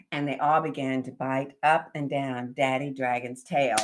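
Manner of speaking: ordinary delivery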